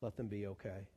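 hushed, low plea to God; serious pleading prayer. Acted. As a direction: beg softly, fading almost away. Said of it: "Sometimes it's more serious".

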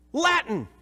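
ordinary talk, nothing marked

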